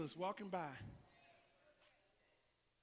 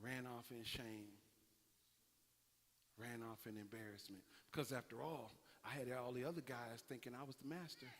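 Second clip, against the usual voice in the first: somber hush; 8 semitones lower, 15 dB quieter than usual. Acted. Somber retelling of Peter's shame.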